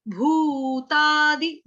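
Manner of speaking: भूतादि stretched very slowly with a long भू; slow teacher demo of भूतादि; slow syllable demo. Shown as a demonstration. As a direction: draw out भूतादि, holding the long u. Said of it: "भ उ, भू".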